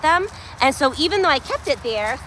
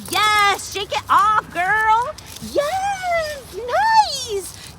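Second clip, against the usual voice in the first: excited cheering; 12 semitones higher, much slower than usual. Real, not acted. Trainer cheering her dog shaking it off, high excited shouts. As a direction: shout encouragement, pitch way up.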